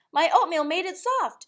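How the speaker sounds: high, loud boast; proud child. A narrator's reading. Mac boasting to mother about his oatmeal, high proud voice. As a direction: brag like a small boy.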